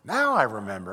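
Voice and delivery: ordinary talk, nothing marked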